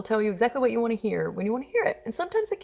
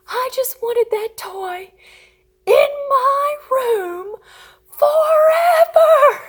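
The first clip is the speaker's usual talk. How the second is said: very high, drawn-out mock bawling, building louder; crying toddler; 8 dB louder, much slower than usual. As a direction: imitate a toddler wailing each word longer and louder. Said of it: "he's in the bed crying".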